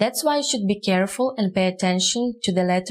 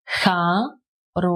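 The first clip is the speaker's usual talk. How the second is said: "ха-ро-ший" drawn out, gaps between syllables; 2 semitones lower, slower than usual. Shown as a demonstration. Teacher repeating for learners, syllable by syllable. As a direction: sound out "ха", "ро", "ший" one at a time.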